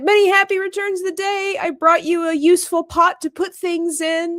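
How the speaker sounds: high, bouncy mock announcement; cheerful greeting. Pooh barges in with his gift, cheerful and oblivious. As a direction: proudly present it, oblivious.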